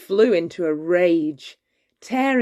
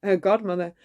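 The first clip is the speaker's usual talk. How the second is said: ordinary delivery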